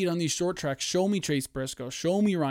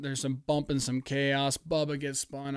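normal talking voice

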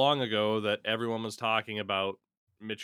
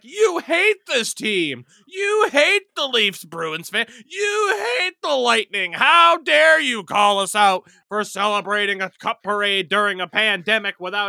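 mock outraged fans; 8 semitones higher, 10 dB louder than usual. Acts out outraged fans' accusations.

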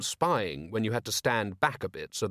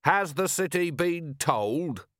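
slow, higher-pitched pointed question; inquiring voice; 7 semitones higher, slower than usual. A narrator's reading. Onlooker needles the ranting merchant, inquiring. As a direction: ask it deliberately from among the crowd. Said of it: "said the inquiring voice".

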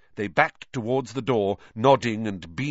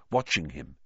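plain reading voice

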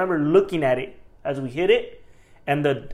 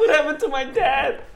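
high-pitched, hammed-up alarm; exaggerated worried wail; mock panic; 11 semitones higher, 4 dB louder than usual. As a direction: imitate a panicked daughter for laughs. Echoing the panicked daughter for laughs.

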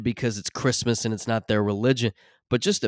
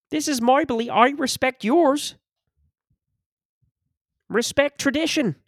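Jordan Peterson impression; 13 semitones higher than usual. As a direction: preach tradition in a high, pinched lecturing voice. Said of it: "I feel like Jordan Peterson".

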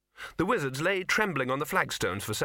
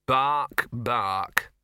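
spoken bark; 4 dB louder than usual. Acted. Talking dog covers up before troll, spoken barks. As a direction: say "bark" as a word, forcefully.

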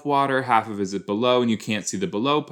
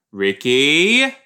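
louder call across the room; 3 semitones higher, 7 dB louder than usual. Pretend. Viewer calling to Ricky on the screen, a louder call. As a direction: shout the name to get her attention.

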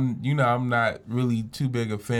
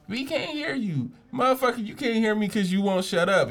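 high, mocking whine of the audience; mocking imitation; 11 semitones higher than usual. Speaker on stage mocking the rude audience, high whine. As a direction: sneer it back at them high. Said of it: "And it was like".

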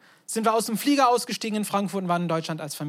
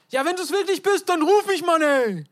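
high, loud, impulsive disciple blurting it out; mock-brash shout, higher and louder; blurting Peter; 7 semitones higher, 6 dB louder than usual. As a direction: play the disciple who talks before he thinks. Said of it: "Das ist, was er sagt eigentlich".

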